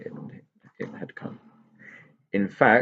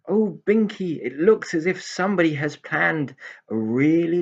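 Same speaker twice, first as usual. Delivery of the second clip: marvelling pixie; 8 semitones higher, 3 dB louder than usual. Acted. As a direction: count the treats in wonder. Pixie marvels at planned day.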